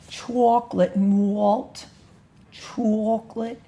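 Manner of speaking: slow drawn-out chocolate malt in a mock New York accent; New York accent imitation. Shown as a demonstration. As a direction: do a New Yorker saying chocolate malt slowly. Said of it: "that's my imitation".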